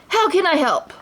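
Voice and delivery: eager genie. A narrator's reading. The genie offers Aladdin help, eagerly. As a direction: jump to help. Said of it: "the genie said".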